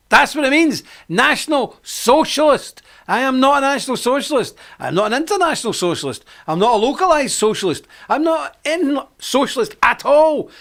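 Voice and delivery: preacher hammering his point; emphatic denial. Real, not acted.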